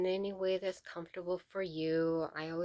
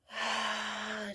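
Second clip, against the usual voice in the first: higher, voiced sigh on the exhale; audible sigh; 4 semitones higher than usual. Real, not acted. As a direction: let out a big "ah" of a breath. Stretching, demonstrating deep breaths.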